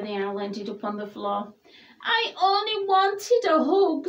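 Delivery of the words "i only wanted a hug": high, mildly tearful protest; a small, hurt plea; sniffed. A narrator's reading. Douglas after the owl falls, sniffing and hurt. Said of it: "sniffed Douglas".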